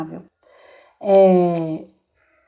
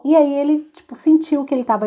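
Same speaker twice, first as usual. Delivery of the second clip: ordinary talk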